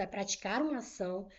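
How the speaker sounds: ordinary talk, nothing marked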